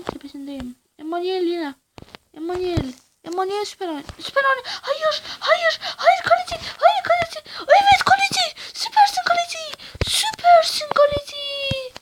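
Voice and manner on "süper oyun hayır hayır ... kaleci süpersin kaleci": screaming in despair. Real, not acted. Gamer screams at the rival goalkeeper, despairing. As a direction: wail at the keeper who keeps saving.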